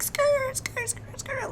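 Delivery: high squealing tire screech imitation. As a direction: mimic tires screeching around the lot. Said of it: "it sounds like somebody's drag racing".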